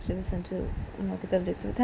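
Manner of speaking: usual speaking voice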